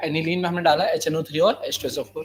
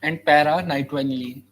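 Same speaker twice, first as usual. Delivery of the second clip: very slow, word-by-word dictation; dictating; much slower than usual. Shown as a demonstration. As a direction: spell it out slowly for copying. Dictating notes to students.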